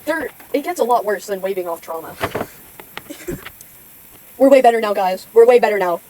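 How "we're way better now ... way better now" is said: louder, insistent; loud reassurance. Real, not acted. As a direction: insist loudly to the viewers.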